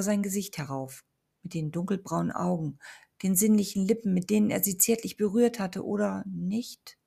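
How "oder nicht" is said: usual speaking voice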